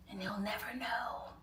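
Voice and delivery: slow, hushed whisper; secretive whisper. Acted. Keeping her poker face about the buyer, secretive slow whisper.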